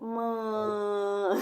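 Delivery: a light mock dog voice calling for mom. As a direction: imitate the dog's little call, loudly. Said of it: "meu cachorro tá aqui fazendo assim". (imitando o cachorro de leve)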